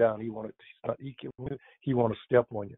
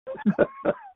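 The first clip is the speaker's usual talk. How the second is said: high-pitched amused laugh; real, spontaneous laughter; 3 dB louder than usual. Real, not acted. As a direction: burst out laughing. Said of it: "I'm laughing because".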